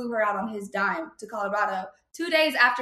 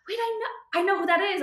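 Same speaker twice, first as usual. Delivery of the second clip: much higher, startled realization; sudden recognition; 9 semitones higher than usual. Retold or imitated. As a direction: catch yourself as it clicks.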